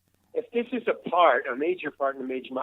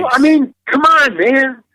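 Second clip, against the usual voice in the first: loud, high-pitched exasperation; exasperated protest; 10 semitones higher, 10 dB louder than usual. Real, not acted. Actor venting to interviewer about auditions, loud exasperated protest. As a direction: protest it loudly, pitched well up.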